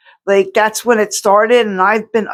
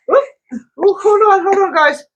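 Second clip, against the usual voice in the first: sudden high yelp of surprise; startled; 12 semitones higher than usual. Real, not acted. Startled by her dog mid-video, high surprised yelp. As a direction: caught off guard, pitch jumps way up.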